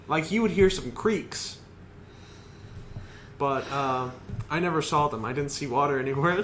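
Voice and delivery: ordinary delivery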